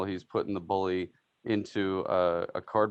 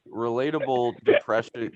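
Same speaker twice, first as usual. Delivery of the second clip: usual speaking voice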